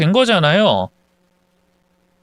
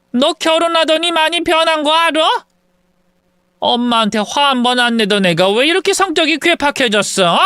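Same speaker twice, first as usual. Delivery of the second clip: higher, heated retort pushing past her son; indignant mother demanding to see her daughter-in-law; angry mother; 7 semitones higher than usual. Retold or imitated. Mother scolding her son at the hospital door, higher heated voice.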